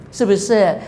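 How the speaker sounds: ordinary talk, nothing marked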